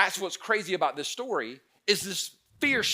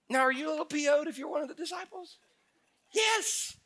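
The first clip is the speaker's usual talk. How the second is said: incredulous; 12 semitones higher than usual. Real, not acted. Preacher mocks disciples' anger.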